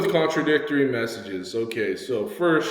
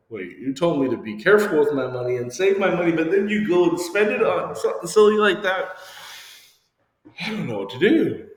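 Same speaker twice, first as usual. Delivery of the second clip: mock indignant child; 7 semitones higher, 5 dB louder than usual. Child voicing confusion at parent.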